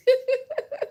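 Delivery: short high giggle; high laugh. Real, not acted. Speaker laughing at her own question, high giggle. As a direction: burst into a giggle.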